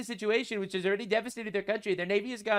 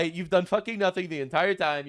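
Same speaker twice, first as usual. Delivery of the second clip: normal talking voice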